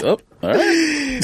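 high, slow, deflated "oh, all right"; meek. Retold or imitated. Fired worker answering the manager, meekly deflated. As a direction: let it drop out small and resigned. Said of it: "And I was like".

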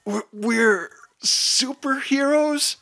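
high, drawn-out mock yell. A narrator's reading. Hero yells back to a toddler, high strained protest. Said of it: "Rainbow Chaser yells".

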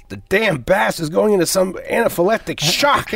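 a hint of the exasperated ship's doctor; Bones-esque rant. Fan imitates Bones grumbling over the dying alien, mildly exasperated. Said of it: "in such Bones-esque way".

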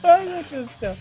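laughing. Real, not acted. Recovering from laughter.

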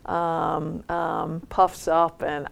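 normal talking voice

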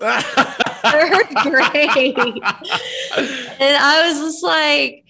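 laughing through the words; real laughter breaking up the words. Real, not acted. Recalling a childish threat, laughing.

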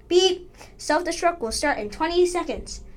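a hint of a machine announcer; computer voice. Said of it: "the computerized voice said".

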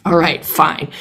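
ordinary delivery

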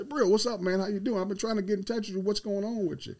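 put-on bright, high greeting to an old player, glad he finally called; cheerful phone greeting. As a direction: pick up sounding thrilled to hear from him. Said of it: "I pick the phone up and say".

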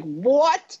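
high, stunned "What?" with a laugh; shocked what. Retold or imitated. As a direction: gasp it out in disbelief. Stunned by news of co-hosting.

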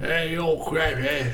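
mumbled thick Cockney; barely understandable Cockney gibberish; overdone Cockney accent. Acted. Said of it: "really hard to understand him".

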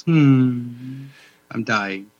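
lightly voiced dragon giving up; dying dragon. Toothless resigning, voiced by friend.

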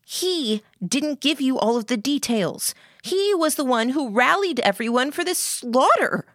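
put-on high-pitched, indignant girl; accusing Lillian.

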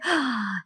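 mock-shocked sharp intake of breath; storyteller's theatrical gasp; dramatic gasp. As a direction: gasp at the plot twist. Mock shock at plot twist.

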